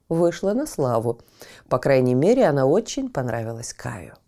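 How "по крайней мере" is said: ordinary delivery, nothing marked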